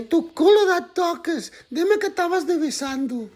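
very high, drawn-out protest; high-pitched mock scolding; comic jealous partner. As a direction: demand an answer in a high put-on voice. Jealous partner confronts him.

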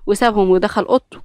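ordinary delivery

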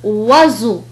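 "وزو" with voiced z between vowels. Shown as a demonstration. Teacher giving another example, z in "وزو". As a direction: say "وزو" with a buzzing middle z. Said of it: "فينطق".

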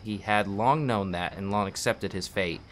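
narrating a tragic Russian novel; story narration. Acted. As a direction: read the novel aloud like an audiobook. Reads a book aloud.

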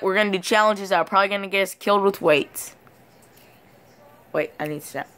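ordinary talk

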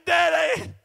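preacher voicing kids who scream to their lost father; children screaming. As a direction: beg daddy, shouting up from heaven. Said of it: "I imagine them kids screaming out saying".

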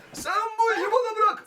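high put-on voice. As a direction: squeak it out like a cartoon hero. Repeated line in a put-on voice. (tiz komik ses)

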